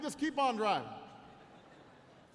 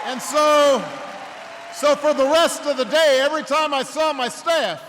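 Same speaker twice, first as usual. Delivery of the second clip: fired up; 5 semitones higher, 16 dB louder than usual. Real, not acted. Still riding the chant's energy.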